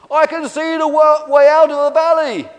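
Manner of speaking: high, loud, sustained calling; faraway shout. Acted. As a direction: call down from far above. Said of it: "in a faraway voice".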